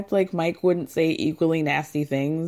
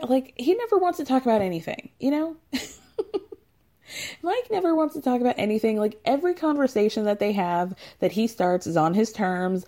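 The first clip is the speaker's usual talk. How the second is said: normal talking voice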